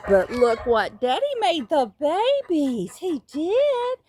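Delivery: slow, high sing-song to chicks. Real, not acted. Baby talk to chicks.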